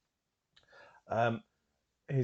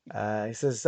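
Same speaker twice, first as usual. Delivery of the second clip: ordinary delivery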